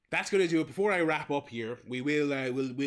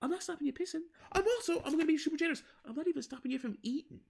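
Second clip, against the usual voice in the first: high, mocking announcement voice, much higher pitch; sarcastic usher speech, pitched way up; mock generous usher. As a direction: ham up a smug cinema usher granting permission. Mock speech to cinema crowd.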